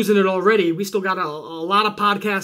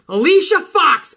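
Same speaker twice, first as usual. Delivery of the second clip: higher, louder "Alicia Fox" called out like a ring announcer; mock announcer; 8 semitones higher, 6 dB louder than usual. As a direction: boom out the winner's name in jest. Announces the imagined winner.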